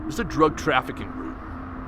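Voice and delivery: lightly acted officer, low and quiet; retold officer. Officer leaning into the car, low confiding delivery. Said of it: "looking at us, he goes".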